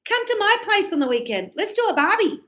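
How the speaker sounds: high, lively call to a mate; Aussie inviting friends. Acted. Aussie inviting friends for the weekend, high and lively. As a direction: pitch it way up, breezy invitation. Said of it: "So we say".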